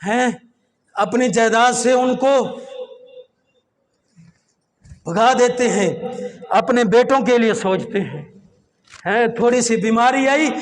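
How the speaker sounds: ordinary talk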